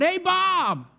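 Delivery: high-pitched mock call to someone far off; pretend shout. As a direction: call out to Bob as if from another house. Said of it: "if I just shouted out".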